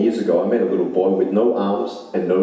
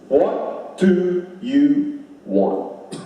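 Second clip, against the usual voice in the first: very slow, high, stretched "What do you want?" with stress on do; a preacher slowing the question right down; slow drawn-out repeat; 10 semitones higher, much slower than usual. Real, not acted. Asks the audience twice.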